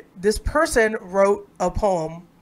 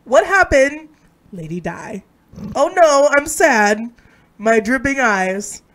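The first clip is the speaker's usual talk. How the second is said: mock-dramatic poem recital; 3 semitones higher, 4 dB louder than usual. Friend rereading a ridiculous poem amid laughter, mock-dramatic recital. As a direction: overplay each short line, pausing between.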